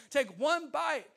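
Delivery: usual speaking voice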